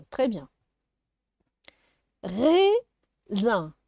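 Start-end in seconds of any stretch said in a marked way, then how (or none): 2.2-3.7 "Raisin" split into a long rising "rai", pause, "sin"; syllable by syllable. Shown as a demonstration. Teacher sounding out syllables for a child, "Raisin" split in two. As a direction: break "Raisin" into two stretched beats. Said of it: "de faire les syllabes".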